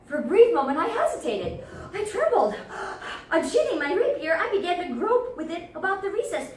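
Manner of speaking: high, loud, startled telling; panicked narration. A narrator's reading. Montresor startled by the screams, panicked. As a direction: blurt it out in alarm.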